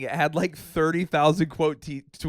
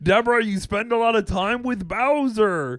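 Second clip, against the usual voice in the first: higher sitcom husband complaining; Ray Romano impression; 6 semitones higher than usual. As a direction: whine at the wife about Bowser. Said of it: "I can't do Ray".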